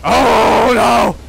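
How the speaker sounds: loud, high oh no; dismayed cry. Real, not acted. Player cries out as the demon catches him, loud dismay. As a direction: cry out as it goes wrong. Caught again.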